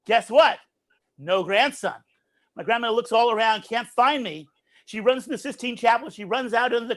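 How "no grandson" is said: normal talking voice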